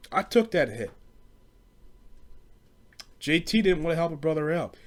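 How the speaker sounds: usual speaking voice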